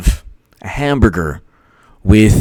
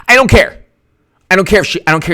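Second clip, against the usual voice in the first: defiant outburst; 7 semitones higher, much faster than usual. Real, not acted.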